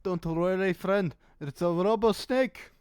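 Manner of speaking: put-on a vague Eastern European merchant accent, lightly done; sly salesman voice with an unplaced European accent; mystery European accent. Shady merchant reassuring a customer, in a put-on European accent. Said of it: "don't ask me what this accent is".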